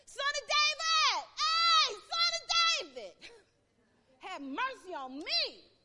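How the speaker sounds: very loud, high, slow cry. Retold or imitated. Beggar shouting over the crowd to Jesus, very loud. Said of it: "he kept on shouting all the more".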